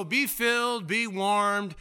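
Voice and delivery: lightly high, sing-song send-off; hammed-up pious blessing. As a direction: bless them sweetly while walking past. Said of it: "You just go".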